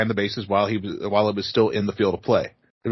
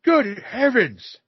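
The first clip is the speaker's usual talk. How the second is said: prim Victorian gentleman; 11 semitones higher, much slower than usual. Pretend. Old-timey gentleman voice.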